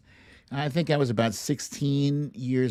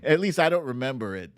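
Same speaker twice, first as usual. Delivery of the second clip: ordinary talk, nothing marked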